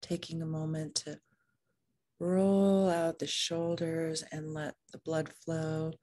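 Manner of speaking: soft, unhurried meditation voice with long pauses; calm, even meditation leading; guided meditation. Real, not acted. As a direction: guide them gently into the body, leaving room to breathe.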